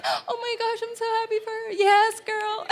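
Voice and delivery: a daughter overhearing her mom, quietly thrilled; teary happy gush. Retold or imitated. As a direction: gush it out through happy tears. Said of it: "and I'm crying".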